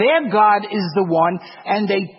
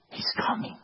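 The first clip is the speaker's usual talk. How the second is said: a bare whispered warning; 11 dB quieter, faster than usual. Real, not acted. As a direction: breathe it without voice.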